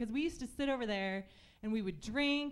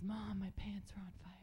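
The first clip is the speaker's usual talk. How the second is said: softer, unvoiced whisper; 3 semitones lower, 10 dB quieter than usual. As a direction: whisper it sheepishly to mom. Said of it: "And he goes".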